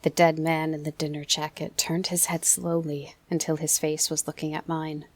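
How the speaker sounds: plain reading voice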